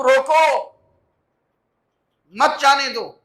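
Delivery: loud "روکو" alone, pause, then "مت، جانے دو" together; pause after "روکو". Shown as a demonstration. Preacher showing congregation the opposite meaning, pause after "روکو". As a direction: stop hard on "روکو", join "مت" to "جانے دو". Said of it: "اس کا مطلب اس کو آگے نہیں جانے دینا".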